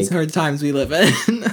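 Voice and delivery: high, loud, laughing lament over hard times; laughing mock drama. Real, not acted. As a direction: ham up the tragedy while cracking up. Laughing at the dramatic texting talk.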